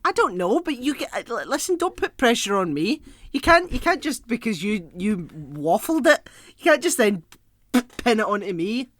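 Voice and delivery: loud, high-pitched indignant pushback; flustered protest. Real, not acted. Co-host refusing pressure to tell the joke, loudly protesting. Challenged to deliver the joke better.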